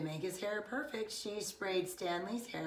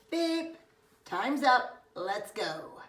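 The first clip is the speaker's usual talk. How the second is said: high, louder beep then brisk; mock timer announcement; 4 semitones higher, 5 dB louder, slower than usual. Friend announcing time's up, mock buzzer. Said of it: "Larry announced".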